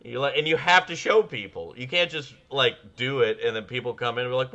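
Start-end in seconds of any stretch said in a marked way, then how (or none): none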